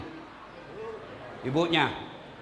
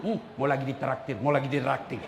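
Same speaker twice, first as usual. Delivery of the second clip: mock gossip; 6 semitones lower, 4 dB quieter than usual. Gossiping people, low voice.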